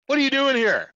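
high, loud barked demand; mock angry shout. Officer confronting a sleepy trucker, angry shout. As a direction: yell it like a hostile officer.

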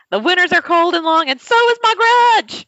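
high, booming mock proclamation; Mad Russian. Imagined Russian driver vows a long grudge, loud theatrical proclamation. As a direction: declaim it loud like a hammed-up villain. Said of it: "he would say it all in Russian".

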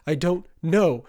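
high, loud desperate denial; shouted. A narrator's reading. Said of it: "Marcus shouted".

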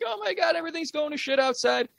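high-pitched retold panic, easing as it goes; imitating someone flustered and panicked; mock panic. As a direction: play a friend panicking, pitch starting high and settling. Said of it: "She's all in a panic".

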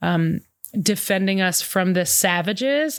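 normal talking voice

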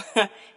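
quick burst of laughter; short laugh. Real, not acted. Laughing as she warns listeners off. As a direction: laughs at her own warning. Laughing at her own warning.

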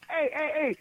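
ordinary delivery

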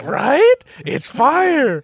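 high-pitched, loud hyped voice; high excited brother voice. Retold or imitated. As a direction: squeak it out, thrilled. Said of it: "my brother's like".

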